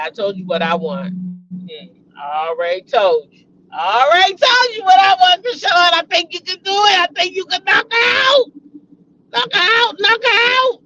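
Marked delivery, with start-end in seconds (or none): 3.7-10.8 high-pitched, hollered pep talk; whooping encouragement, pitched high; excited cheering. Real, not acted. Cheering on niece before fight.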